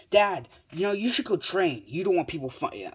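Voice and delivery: put-on high, eager kid pestering his dad; excited son.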